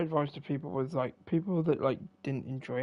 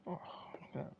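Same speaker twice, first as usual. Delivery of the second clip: whispering; 11 dB quieter than usual. Real, not acted. Quiet remark during the game.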